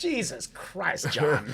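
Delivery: high, drawn-out exasperation; slow, stretched-out mock appeal; mock dismay. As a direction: scold him in comic disbelief. Mock dismay at Cena's loud calling.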